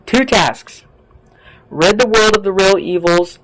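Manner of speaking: imitating his voice. Repeating his two tasks as he said them, light imitation. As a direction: echo him, pitched a little up. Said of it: "Sound like just like him".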